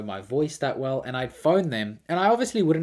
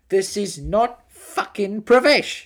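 loud, high, slow exasperated shout; mock furious call recipient; fed-up outburst; 9 semitones higher, 10 dB louder, much slower than usual. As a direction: imitate a man losing it, punching every word. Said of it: "eventually it got to the point where he was just like".

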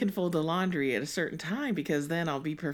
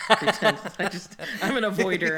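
laughing; 4 semitones higher, 5 dB louder than usual. Real, not acted. Laughing about hiding socks.

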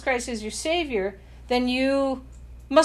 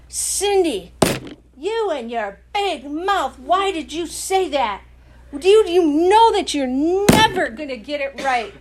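ugly, powerful accusing voice, higher and louder; nasty, pounding Satan voice, raised and loud; 4 semitones higher, 7 dB louder than usual. Retold or imitated. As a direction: condemn and pound her down. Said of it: "That's the voice of Satan".

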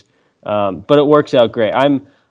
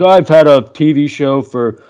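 higher, lightly mocking take on his proud dad; imitating dad; 4 semitones higher, 3 dB louder than usual. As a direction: play the boastful old-timer dad, pitched up. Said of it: "and he's like".

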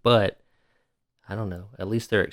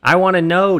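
loud, high exasperated complaint; frustrated outburst; 8 semitones higher, 7 dB louder than usual. Real, not acted. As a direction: demand to know, fed up. Outraged at missing follow-up.

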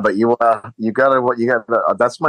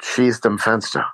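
slow, slightly raised German command; imitating Hans; 4 semitones higher, slower than usual. Hans orders Carl to shoot the window, lightly imitated. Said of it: "he looks at Carl, and he's like".

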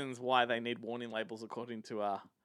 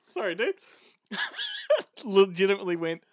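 laughing; 5 semitones higher, 4 dB louder than usual. Real, not acted. Laughing after spitting water.

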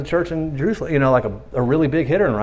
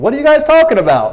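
mock-innocent question, higher; playful, high; 10 semitones higher, 5 dB louder than usual. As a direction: play dumb, teasing, in a high voice. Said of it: "Just having fun".

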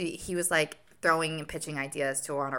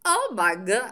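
put-on a touch of an Italian accent, a bit louder; mock Italian gasp; 5 semitones higher, 6 dB louder, slower than usual. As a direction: try an Italian star-struck sigh. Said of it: "I can't do it".

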